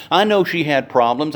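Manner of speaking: ordinary delivery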